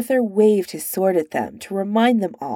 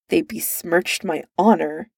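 ordinary delivery

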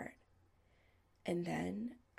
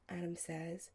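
usual speaking voice

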